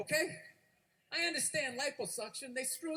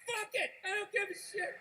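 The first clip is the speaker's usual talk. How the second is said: high-pitched exasperated quitting; acted giving up; 7 semitones higher than usual. Customer quitting after a row and a half, exasperated. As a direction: tap out and stop caring.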